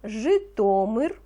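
teacher dictating single words, slow and distinct; slow dictation. Acted. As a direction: give each word slowly so children can write. (повільний диктант учителя)

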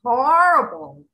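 loud, dramatic stress; booming doom; horrible. Real, not acted. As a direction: hit it hard with dread. Dire warning of war.

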